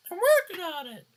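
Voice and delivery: high, loud whiny voice; Chris Chan impression. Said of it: "Obligatory Chris Chan reference".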